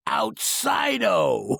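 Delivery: "Outside-o" very slow, with the o dragged out; gringo "outside-o". Retold or imitated. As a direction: stretch the fake Spanish o. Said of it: "He's all".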